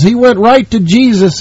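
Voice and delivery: normal talking voice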